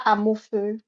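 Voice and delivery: ordinary talk, nothing marked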